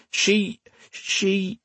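high, protesting, then petering out; trailing off. A narrator's reading. Man facing armed men, defence trailing off. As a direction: start in defence and falter away. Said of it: "His voice trailed off".

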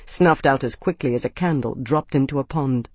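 ordinary delivery, nothing marked

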